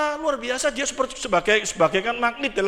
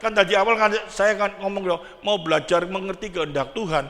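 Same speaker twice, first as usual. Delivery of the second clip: ordinary talk, nothing marked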